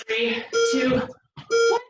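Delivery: high, energetic count; calling countdown. Real, not acted. Instructor counting down for the class, high and bright. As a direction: call it out to push the class.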